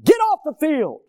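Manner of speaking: high, loud reenacted outburst; light into him with a yell; angry yell. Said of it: "I just lit into him".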